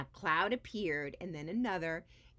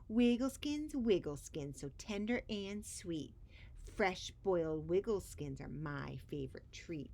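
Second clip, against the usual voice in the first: sing-song monster ditty; horrible little song. Acted. Scratcher sings over the pot, horrible sing-song. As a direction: croon it with relish, creepy. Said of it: "sang a horrible little song".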